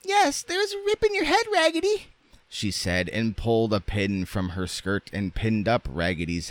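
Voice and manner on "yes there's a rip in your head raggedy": extremely high, squeaky doll; French doll voice. A narrator's reading. French doll inspecting her friend's head, squeaky voice. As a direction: pipe it up in falsetto.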